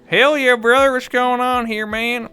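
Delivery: high, loud hillbilly hollering; hillbilly Mango. Acted. Mocking Mango the streamer, whooping hillbilly voice. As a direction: put on a loud, high-pitched hillbilly twang. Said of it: "Mango talks like a hillbilly?".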